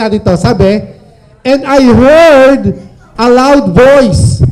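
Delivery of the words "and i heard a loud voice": slow and louder, each word weighed; dramatic scripture reading. Acted. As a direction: proclaim the verse slowly. (mabagal at malakas na pagbasa ng talata)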